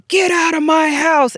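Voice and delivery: imitating a possessed-sounding yell in the dark; deep man voice. Narrator reenacting her friend's sudden yell at night, loud mock shout. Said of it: "in, like, a deep man voice".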